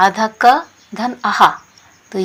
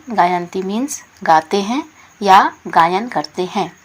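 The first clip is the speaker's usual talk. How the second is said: ordinary delivery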